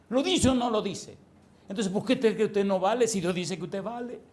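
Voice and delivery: loud, high challenge to the congregation; full-force preaching. Real, not acted. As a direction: fire rhetorical questions at the crowd.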